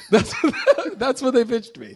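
cracking up, pitch riding high; real laughing. Real, not acted. Telling the absurd monkey pitch, laughing through it. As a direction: struggle to get it out through laughter.

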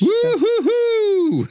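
a long high cheering hoot; excited whoop. Real, not acted. Cheering whoop for Katrin Fröhlich. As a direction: whoop with delight at her name.

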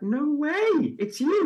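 high, loud mock astonishment; excited recognition. Bumping into someone in Belfast, high excited recognition. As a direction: exclaim as if spotting an old acquaintance. Retold surprise at meeting someone known.

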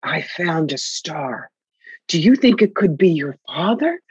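imitating Shirley's hushed, airy phone voice; a breathy older woman wondering aloud. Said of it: "Shirley in her breathy voice going".

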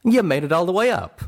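high, loud character voice, insistent; Calamity asserting. A narrator's reading. Friend telling Little Pip about the climb, asserting. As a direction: assert it firmly, pitched well up. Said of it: "Calamity asserted".

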